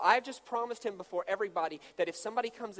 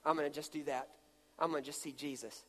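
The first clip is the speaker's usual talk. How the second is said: voice of God; 6 semitones lower, 8 dB quieter than usual. Acted. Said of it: "So God says".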